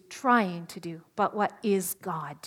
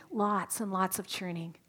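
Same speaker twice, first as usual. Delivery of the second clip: usual speaking voice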